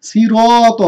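সিরত with a heavy রা; recited model word. Shown as a demonstration. (মোটা রা-তে উচ্চারণ)

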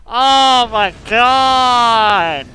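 loud, slow, drawn-out cry of frustration; exasperated shout. Real, not acted. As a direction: stretch it out and yell at the game. Frustrated outburst while losing in game.

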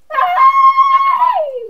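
very high, long held squeaky hi; silly high hi. Pretend. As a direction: squeak it out comically. Silly greeting with music.

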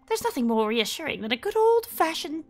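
a gentle grandmotherly figure, lightly played; voicing Alice. Reading Alice's dialogue aloud.

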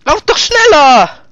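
loud high panicked yell; being chased. Real, not acted.

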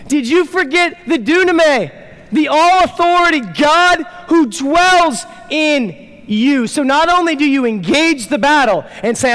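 rally them to fight with confidence; full-force preaching. Acted. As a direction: pastor challenging believers who live small.

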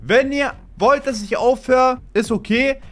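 high loud plea to fans; rallying shout. Real, not acted. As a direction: whip the viewers up to comment.

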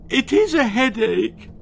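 high, loud tearful whine; tearful whimper. Acted. Daughter confirming the headache, tearful and high. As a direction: push the sorrow. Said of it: "trembled the wet-eyed Wen".

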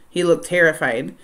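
ordinary delivery

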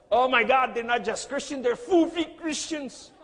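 exclamation scandalisée, forte et aiguë; s'écrier, faussement horrifié devant la découverte; choc rejoué. Retold or imitated. Acting out his shocked reaction.

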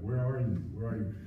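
a hint of a tender father calling out. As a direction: call him softly like a worried father. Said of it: "he's saying this tenderly".

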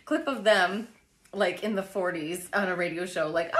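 ordinary talk

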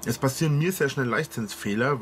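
normal talking voice

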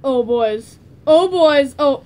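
loud sing-song taunt; mock menacing call. Taunting friends.

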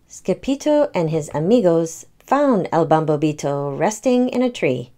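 ordinary delivery, nothing marked

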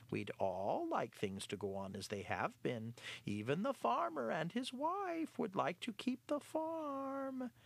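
sly cat voice dropping to a hush at the end; the cat pitching his plan to the mice; lightly voiced sly cat. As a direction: lead them slyly to the idea. Clarence voiced.

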